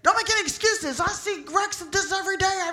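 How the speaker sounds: high, loud mock tirade; imitated officer yelling a scolding; reading the riot act. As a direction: rant like a fed-up cop. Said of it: "giving me the riot act".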